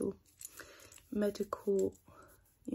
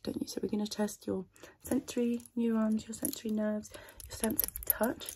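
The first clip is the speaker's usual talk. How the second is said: ordinary talk, nothing marked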